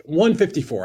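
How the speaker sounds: loud, firm restatement. Retold or imitated. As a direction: say the price again with force, pushing it through. Repeated offer, louder and higher.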